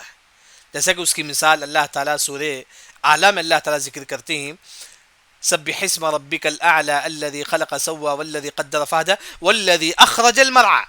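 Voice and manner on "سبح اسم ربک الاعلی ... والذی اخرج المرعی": slow, measured chanted recitation; Quran recitation. Acted. Preacher recites Surah Al-A'la to the class, slow and chanted. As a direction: draw out each verse like tilawat.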